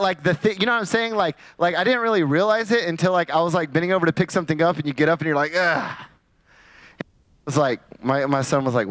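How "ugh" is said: imitated effortful groan of getting up. Preacher getting up from bending over, mock groan. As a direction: groan like an aging back straightening. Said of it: "like, groan".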